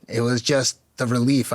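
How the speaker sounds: ordinary delivery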